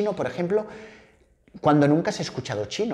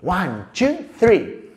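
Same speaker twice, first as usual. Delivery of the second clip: rhythmic picture-book chant; storytelling for toddlers; 3 semitones higher, much slower than usual. Shown as a demonstration. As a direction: count slowly and playfully for little ones.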